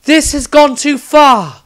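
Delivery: lofty sorceress voice halting the fight; stern newcomer. Acted. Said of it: "spoke the newcomer".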